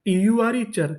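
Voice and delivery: ordinary delivery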